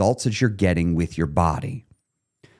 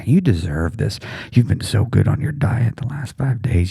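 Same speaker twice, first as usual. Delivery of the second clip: a sly tempting whisper. Retold or imitated. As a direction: breathe it like a tempting inner voice. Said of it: "that whisper right there".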